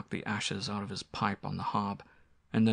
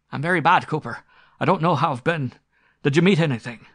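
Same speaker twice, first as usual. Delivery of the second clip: high, loud, agitated rustic voice; shaken squire; 7 semitones higher, 9 dB louder than usual. Acted. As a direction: ask urgently, as a frightened master. Shaken squire confides in his butler.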